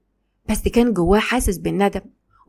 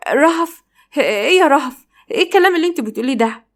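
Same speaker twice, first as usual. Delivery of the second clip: high-pitched, quick startled questions; 6 semitones higher than usual. Acted. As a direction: caught off guard, voice jumps up. Marwa caught off guard by Rahaf. (بنبرة عالية متفاجئة)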